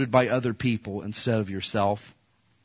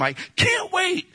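high, slow, excited puppy voice; eager little dog; slower than usual. Pretend. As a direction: squeal it like a dog waiting for its owner. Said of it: "And I'm just gonna wag my tail".

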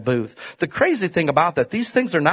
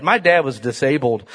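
normal talking voice

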